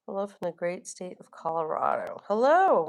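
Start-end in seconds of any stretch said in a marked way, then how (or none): 2.3-2.8 excited greeting. Real, not acted. Greeting a new viewer.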